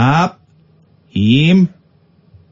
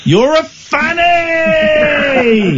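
shouted; 11 semitones higher, much slower than usual. Pretend. Host shouts reversed clip's words.

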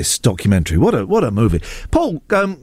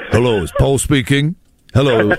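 lower, mock-serious professional phone voice; deep man's voice; 5 semitones lower than usual. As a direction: answer the phone gravely like a grown man. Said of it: "it was a deep man's voice".

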